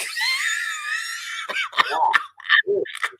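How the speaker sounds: losing it completely over the story; screaming laugh. Real, not acted. Shrieking with laughter at the TikTok comments.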